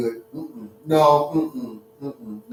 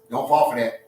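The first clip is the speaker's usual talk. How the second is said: normal talking voice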